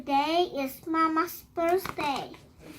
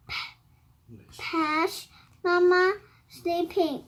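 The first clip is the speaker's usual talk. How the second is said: plain reading voice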